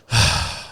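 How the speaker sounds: resigned exhale, acted out; mock sigh of dread; weary sigh. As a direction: let out a heavy here-we-go sigh. Dreading the gym friend's chat.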